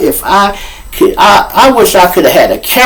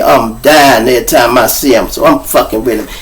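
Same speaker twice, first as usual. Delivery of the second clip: ordinary delivery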